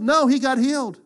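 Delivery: ordinary talk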